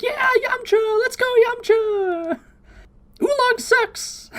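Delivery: very high, slow squeaky cheering; squeaky cheerleader voice. Retold or imitated. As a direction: cheer it like a tiny fan. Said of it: "they keep going like".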